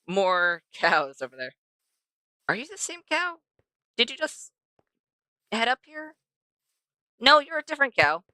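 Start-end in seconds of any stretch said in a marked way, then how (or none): 2.5-8.2 lifted, gentle voice for the cow; talking to a cow. Real, not acted. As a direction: coo at the cow like a pet.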